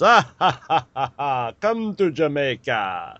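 put-on big laughing Jamaican accent, slowing at the end; hearty mock Jamaican tourism pitch; Jamaican ad guy. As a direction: boom out a jolly Jamaican welcome. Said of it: "the main thing that he said was".

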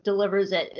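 ordinary delivery

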